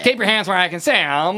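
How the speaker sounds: smug comic cop command; John Lovitz impression. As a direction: bark it like a pompous TV cop. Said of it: "I wish I could do John Lovett's voice".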